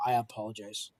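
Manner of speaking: ordinary delivery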